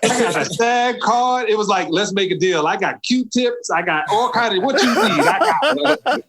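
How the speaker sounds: high, comic salesman patter; hammed-up eager bluff. Newcomer offering the director anything, hammed-up eager bluff. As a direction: pile on offers in a high, laughing voice.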